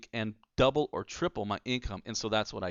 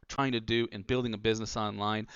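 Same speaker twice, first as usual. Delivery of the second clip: ordinary talk, nothing marked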